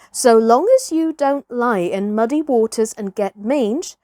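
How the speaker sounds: plain reading voice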